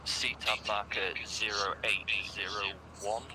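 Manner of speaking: slow, steady radio grid read; calm radio call for fire. Real, not acted.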